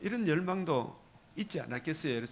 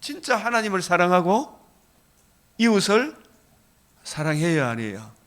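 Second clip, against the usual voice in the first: louder and higher, fervent preaching cadence; preaching at full force; 4 semitones higher, 7 dB louder than usual. Acted. Pastor urging the congregation on love of God, full-force preaching. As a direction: drive each phrase home with rising fervor.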